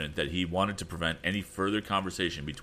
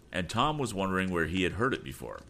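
ordinary delivery, nothing marked

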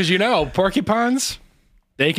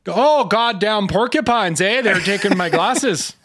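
put-on lightly done Canadian accent with eh; 4 semitones higher than usual. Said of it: "me doing a Canadian accent".

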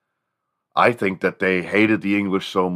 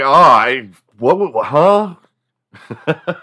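loud, startled stammer; mock confusion, drawn out and rising; flustered; 3 semitones higher, 8 dB louder, much slower than usual. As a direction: stumble over it, caught out and baffled. Caught nodding, not understanding the wife.

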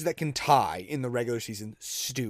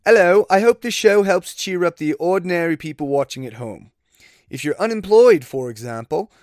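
put-on amateur British accent, pitched up, louder; horrible British accent; 2 semitones higher, 5 dB louder than usual. Podcaster reading a comedian's tweet, horrible British accent. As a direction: attempt a British accent, badly. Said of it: "do a British accent".

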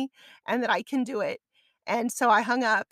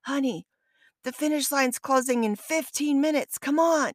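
raised, urgent call to his wife; urgent husband; 5 semitones higher than usual. Retold or imitated. Husband rushing his wife to the finish, raised and urgent. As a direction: hurry her along, voice raised. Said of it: "and he's like".

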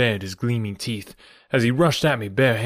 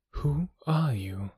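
whispered, slow and higher; sweet whisper; 5 semitones higher, 6 dB quieter, slower than usual. A narrator's reading. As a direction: breathe the question softly. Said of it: "said the sweet voice of my companion".

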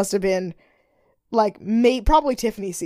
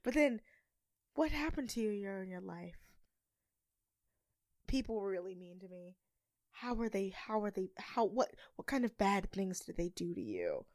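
much softer probing questions; mock hushed Tyra; 2 semitones lower, 17 dB quieter than usual. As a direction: lean in and ask very softly. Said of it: "Tyra's like".

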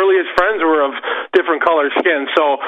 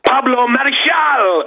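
put-on Pablo Marichal drawn out slowly and higher in a Spanish accent; rolled Spanish name; 6 semitones higher, slower than usual. [name] reading the jug list, playing up Pablo Marichal in a Spanish accent. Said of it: "he would play into, uh, the names".

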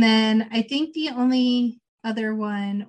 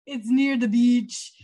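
mock Jamaican accent; slower than usual. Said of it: "pretending to be Jamaican".

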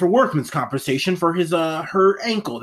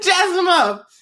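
excited shout; 6 dB louder, slower than usual. Real, not acted.

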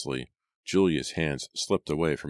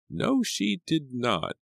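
a firm, higher housekeeper voice, slowed down; 12 semitones higher, slower than usual. A narrator's reading. Housekeeper defends Lucy to Hannah, firm and slow. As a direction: plant your feet and deny it, slowly. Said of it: "said firmly".

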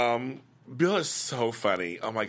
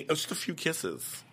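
mock plea, softer and higher; imitated soft, high-pitched protest; imitating repeated excuse; 5 semitones higher, 6 dB quieter than usual. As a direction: play down the excuse in a soft, raised voice. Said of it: "he keeps saying".

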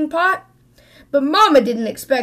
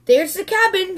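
excited call; 7 semitones higher than usual. A narrator's reading. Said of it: "shouted Sister".

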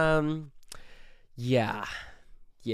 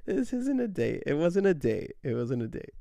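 first line jumps much higher, then settles as he repeats it; insisting it wasn't a date; 4 semitones higher than usual. Real, not acted. Clarifying to viewers it was no date, emphatic. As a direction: protest fast, then calm the denial. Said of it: "I have to clarify".

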